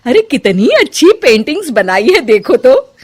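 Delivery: put-on loud, thrilled older woman admiring; mother-in-law discovering her daughter-in-law is an artist; delighted mother-in-law. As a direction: gush over the paintings.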